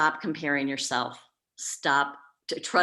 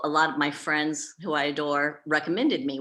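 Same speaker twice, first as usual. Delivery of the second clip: normal talking voice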